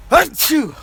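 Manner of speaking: loud high sneeze; sneezing. Real, not acted. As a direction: let out a big real sneeze. That was the third time's a charm.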